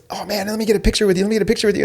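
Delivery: high, quick, eager asking; lightly imitated excited young man; eager request. Student asking his coach for a photo, high and eager.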